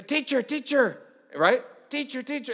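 high, whiny teacher teacher; pestering kids. Retold or imitated. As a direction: nag like a needy child.

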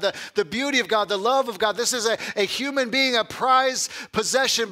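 high, loud, fervent preacher's voice; preaching at full force. Acted. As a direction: lift the room, proclaiming each phrase.

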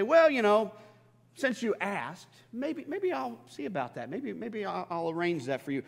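high, lilting pretend maybe; hammed-up coy stalling over a favor; mock noncommittal hedging. Mimicking God hedging a favor.

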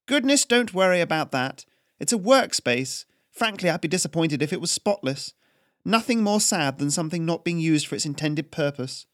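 cool aristocratic challenge; imperious noblewoman Haryana. Acted. Noblewoman Haryana challenges the tax collector, imperious and crisp.

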